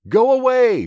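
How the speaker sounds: high, loud shout. Acted. Judith in the trunk shouting at the beast, loud. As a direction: yell it to drive the beast off. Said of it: "she shouted".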